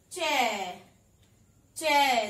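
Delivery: चै called out loud, ai vowel drawn clearly; teaching the sound. Shown as a demonstration. Teacher showing how च with ऐ is read, loud. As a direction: model चै slowly for the class to repeat. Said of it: "इसे हम पढ़ेंगे".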